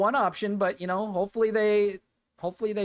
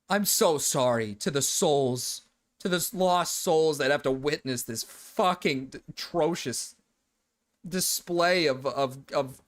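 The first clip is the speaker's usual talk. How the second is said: lower, slowed-down fake solemn condolence; mock solemn apology; 6 semitones lower than usual. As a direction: parody grave mourning, lower and slow. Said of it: "He's like".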